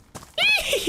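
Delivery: high shrieking cackle; witch cackle. Acted. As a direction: end on a wicked hag laugh. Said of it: "I got that one down".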